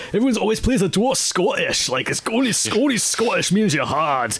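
high-pitched Scottish dwarf; Scottish accent. Acted. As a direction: put on a hard Scottish accent. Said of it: "they're always played Scottish".

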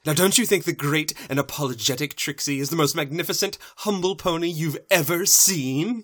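quick showboating voice, rising at the end; grandiose boast. Acted. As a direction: build to a big theatrical flourish. Trixie boasts in showman style.

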